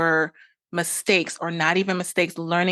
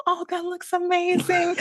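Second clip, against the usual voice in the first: high, breathy-free gush of delight; mock dreamy delight; 12 semitones higher than usual. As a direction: gush over the photos in a high voice. Gushing over pictures.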